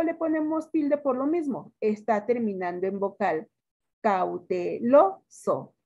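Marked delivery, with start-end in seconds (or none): 4.0-5.7 "Cauteloso" very slow, syllable by syllable, LO marked; stress on LO. Shown as a demonstration. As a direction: break up "Cauteloso" for a class, leaning on "lo". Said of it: "¿Cuál es la sílaba tónica?". ("Cauteloso" muy lento, sílaba por sílaba, marcando LO)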